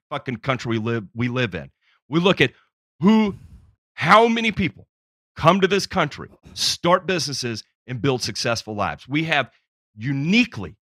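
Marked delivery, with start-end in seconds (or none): 2.1-10.8 louder, higher, fired-up speech; impassioned rant. Real, not acted. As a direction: build into a patriotic crescendo. Patriotic rant rising in force.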